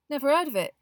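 lightly voiced teenage boy; a hint of a dismissive boy; Ron voice.

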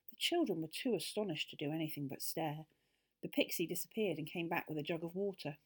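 usual speaking voice

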